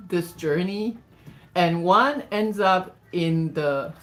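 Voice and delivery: slow, emphatic picture-book reading; story read-aloud. Acted. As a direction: draw out the rhymes, punching key words.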